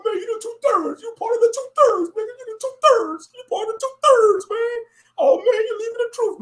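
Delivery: mocking camp brother. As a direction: wail and shout the accusations.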